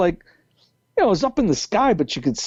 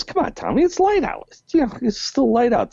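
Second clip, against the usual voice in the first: put-on higher, pleading kid-brother voice; imitating brother coaxing; 10 semitones higher than usual. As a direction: coax him to come out and play. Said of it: "He goes".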